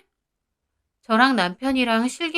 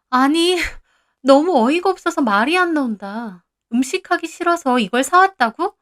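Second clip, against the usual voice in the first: incredulous; 5 semitones higher, 4 dB louder than usual. Acted. 시누이가 어이없어 따짐.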